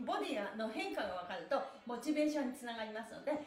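normal talking voice

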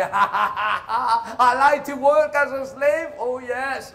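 laughing loud and high, fake happy; hammed-up happy slave laughter; mock cheerful slave. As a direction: laugh along like a grinning servant.

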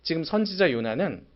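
usual speaking voice

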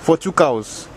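put-on thick West African accent, easygoing; African uncle accent. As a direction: play a boastful African uncle. Same character voice.

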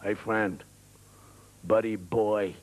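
put-on very low American accent; a deep, deliberate American warning; threatening American. American threatens his buddy, low American menace. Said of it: "Americans are always".

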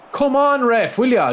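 high, loud yell at the referee; losing it with the ref over a missed foul; furious shout. Real, not acted. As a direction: scream the appeal in outrage. Protesting the referee.

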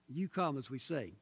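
ordinary delivery

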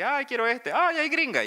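voz muy aguda de capricho; antojo exagerado. Retold or imitated. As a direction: elige como niña caprichosa. Eligiendo en catálogo de Grindr. (voz aguda y antojada)

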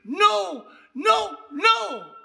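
loud, high cry of refusal; retold scream. Teacup reader recoiling from the cup, imitated scream. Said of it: "and she screamed".

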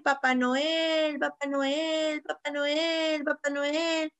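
long, sad calls into the distance; sad reindeer calling. Acted. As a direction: reindeer calling out sadly for Papá Noel, slowly. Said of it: "y se puso a gritar".

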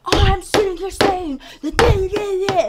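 mock her mockingly at the top of your voice; boss's angry mocking. Boss mocking Rhonda over the papers, loud sneering rant. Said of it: "And he's going like this".

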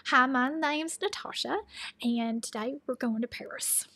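slow, drawn-out country twang; exaggerated country accent. Shown as a demonstration. Vlogger jokily introducing a trip to Paris, exaggerated country accent. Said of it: "I could really up my country accent".

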